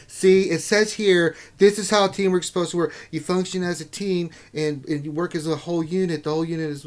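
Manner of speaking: put-on girl voice reading.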